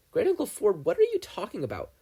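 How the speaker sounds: confused Dipper. Acted. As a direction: ask in bewilderment.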